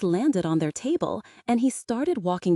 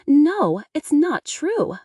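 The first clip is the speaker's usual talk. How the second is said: sobbing; 8 semitones higher, 6 dB louder, much slower than usual. A narrator's reading. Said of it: "Megan sobbed".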